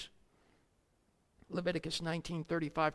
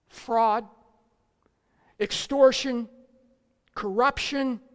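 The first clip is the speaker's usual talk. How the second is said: preaching at full force; 6 semitones higher, 11 dB louder, slower than usual. Acted. Preaching list.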